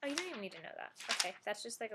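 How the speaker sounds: ordinary talk, nothing marked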